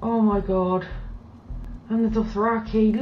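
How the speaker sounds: normal talking voice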